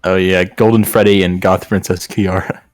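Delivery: ordinary talk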